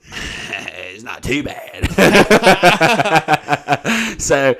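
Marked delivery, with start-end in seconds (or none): 1.9-4.1 loud high burst of laughter. Real, not acted. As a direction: crack up hard. Cracking up at own joke.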